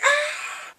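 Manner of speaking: high, loud scream of fright; mock startled scream. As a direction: scream as if something jumped out. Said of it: "He's like".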